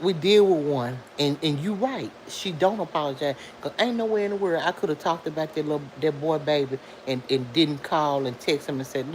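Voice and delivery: usual speaking voice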